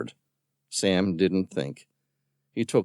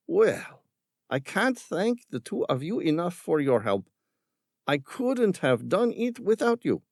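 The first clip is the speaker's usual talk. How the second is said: higher, falsely grateful villain voice; Ramirez, smooth villain; 11 semitones higher than usual. A narrator's reading. Villain feigning thanks to the boys. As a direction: thank them with hollow politeness.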